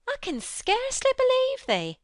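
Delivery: amazed, can't take it in; protested. A narrator's reading. Martha protests she can't believe Mary. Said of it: "she protested".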